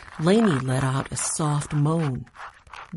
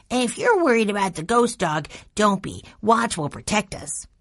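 put-on higher-pitched little boy's voice; little brother Benny, reassuring; 5 semitones higher than usual. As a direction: play a small boy earnestly reassuring his brother.

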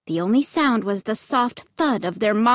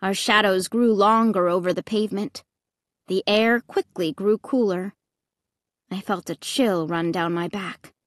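ordinary delivery, nothing marked

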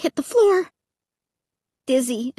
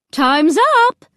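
louder, bright call over a speaker; loudspeaker announcement; 4 semitones higher, 5 dB louder than usual. A narrator's reading. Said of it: "a woman's voice said".

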